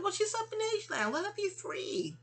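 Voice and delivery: high, lightly mocking friend's voice. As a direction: quote the friend in a squeaky high tone. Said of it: "My friend's like".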